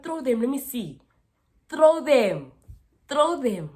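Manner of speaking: high, loud repeated dare; daring challenge. Retold or imitated. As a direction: taunt her into a fight, loudly. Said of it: "I literally told her".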